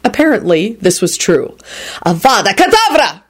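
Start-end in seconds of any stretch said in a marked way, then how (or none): 2.0-3.2 higher-pitched spell cry; frenetic scream. A narrator's reading. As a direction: cry the killing curse frantically. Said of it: "Radu screamed frenetically".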